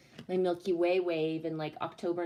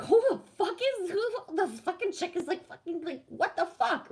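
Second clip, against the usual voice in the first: much higher, fast, incredulous; mock disbelief; 10 semitones higher than usual. Streamer reacting to her own replay, high incredulous. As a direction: squeal it in disbelief. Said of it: "I will literally watch myself and think".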